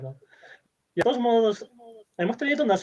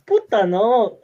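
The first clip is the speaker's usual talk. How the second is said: shocked outburst; 5 semitones higher, 9 dB louder, much slower than usual. Real, not acted. Shocked reaction.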